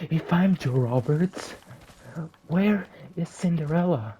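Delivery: high alarmed cries of the victim; transformed victim voice. Acted. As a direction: ask in shocked panic. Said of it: "And she's like".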